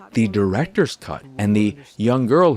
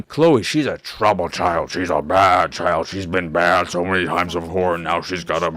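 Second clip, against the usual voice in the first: mock disapproving; 4 semitones lower than usual. Mocking the school's judgment.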